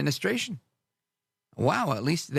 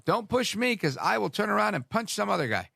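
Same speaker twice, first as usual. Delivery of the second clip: higher-pitched mock threat; mock tough talk; 7 semitones higher than usual. Retaliator threatening to punch some other guy, mock tough talk. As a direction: play at picking a fight.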